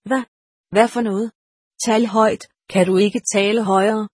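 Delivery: usual speaking voice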